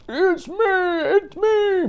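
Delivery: very high, slow whinnying voice; high slow horse voice. Acted. Horse answers from under the mask, high and slow. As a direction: stretch it out like a horse.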